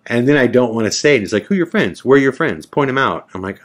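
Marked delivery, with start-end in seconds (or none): 1.5-3.3 high-pitched, rapid-fire retold demand; demanding interrogator. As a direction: imitate a guard pressing hard for answers. Acting out guard demanding names.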